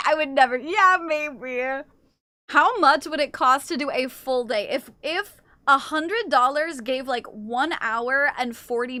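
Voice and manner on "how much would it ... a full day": usual speaking voice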